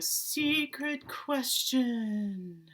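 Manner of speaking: drawn-out, singsong announcement; secret-question signal. Pretend. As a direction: stretch it out slowly like a mystery cue. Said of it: "that is your signal".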